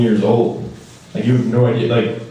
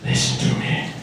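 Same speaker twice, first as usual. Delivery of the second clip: hushed, menacing whisper; whispered order to the peasant student; whispered command; 6 dB quieter than usual. Retold or imitated. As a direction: breathe it like a threat.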